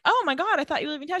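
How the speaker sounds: high-pitched startled imitation; retold surprise. Jeremy finds Anna at the door, in high mock surprise. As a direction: act taken aback, pitch shooting up.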